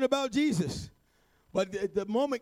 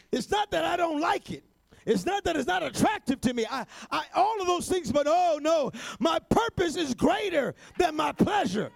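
higher, shouted exhortation; fervent preaching; 4 semitones higher than usual. Acted. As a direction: drive the point home to the congregation.

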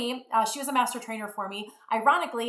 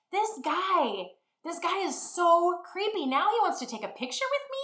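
much higher, incredulous inner voice; thinking he's creepy, voice pitched way up; creeped out; 9 semitones higher than usual. Retold or imitated. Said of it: "'Cause now I'm thinking".

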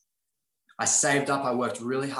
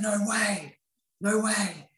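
amazed no way; 8 semitones higher, much slower than usual. Retold or imitated. Retelling his reaction to Lauren's job, excited. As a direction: gasp it out in delighted disbelief. Retold reaction to dancer's news.

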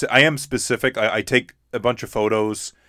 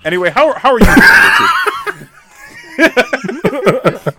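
exaggerated host voice; 13 semitones higher, 9 dB louder, faster than usual. Playful host-style question.

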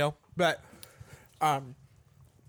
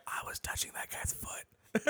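whisper; 11 dB quieter, slower than usual. Retold or imitated. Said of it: "be like".

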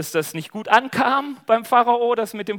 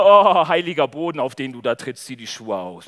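voice of God; 6 semitones lower, 7 dB louder than usual. Acted. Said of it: "kommt plötzlich eine Stimme".

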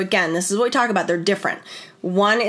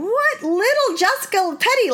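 much higher, stunned disbelief; incredulous; 12 semitones higher than usual. Retold or imitated. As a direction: squeak out the name like you can't believe it.

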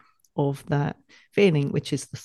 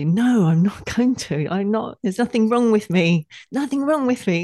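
rising, louder defiant protest, climbing higher each phrase; defiant child; 7 semitones higher, 5 dB louder than usual. Pretend. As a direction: stand up to the teacher, half laughing. Child defying shaming teacher.

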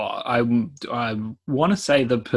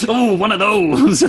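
mock excited reaction; 9 semitones higher, 8 dB louder than usual. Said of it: "It's like".

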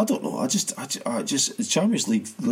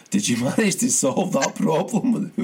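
can't hold it together, laugh mid-line; laughing through the quote; 3 semitones higher than usual. Retold or imitated. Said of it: "And he's like".

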